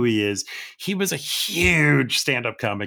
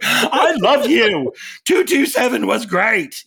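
much higher, louder, slow laughing gush; starstruck gushing; 11 semitones higher, 6 dB louder, slower than usual. Retold or imitated. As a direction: gush at her, tipsy and laughing. Drunk fan gushing to Regina King.

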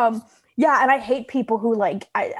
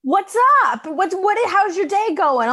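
chirpy mock texter; 8 semitones higher, 3 dB louder than usual. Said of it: "they're like".

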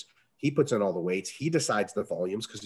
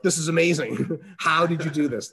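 raised, louder amazed gush with a laugh; amazed diner; 7 semitones higher, 5 dB louder than usual. Retold or imitated. Diner praising the chef's dish, amazed and laughing. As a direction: gush over the food, laughing.

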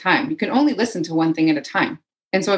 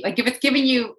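ordinary talk, nothing marked

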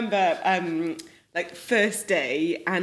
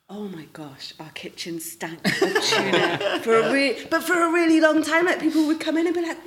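ordinary talk, nothing marked

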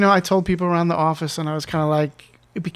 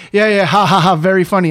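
loud, higher, mock laughing words; unimpressed, louder sarcastic retort; sarcastic dismissal; 3 semitones higher, 8 dB louder than usual. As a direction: brush off the prank with fake ha-has. Retold disbelief at supposed prank.

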